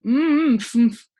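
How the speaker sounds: muffled hum through a mouthful; mumbling with a full mouth. A narrator's reading. Earl stuffed full, mumbling through his mouthful. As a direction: mumble it, cheeks stuffed with food. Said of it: "mumbled the Earl".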